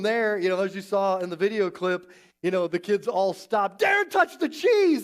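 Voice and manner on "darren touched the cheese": put-on high, loud kid's cry of alarm; hammed-up playground yell; mock alarmed shout. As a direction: shout it out like a scandalized schoolkid. Acts out kids' alarmed cry.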